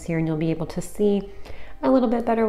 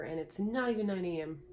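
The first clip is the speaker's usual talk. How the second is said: usual speaking voice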